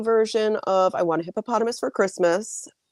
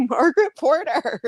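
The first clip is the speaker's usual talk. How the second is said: laughing greeting; 9 semitones higher than usual. Retold or imitated. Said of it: "but I did say".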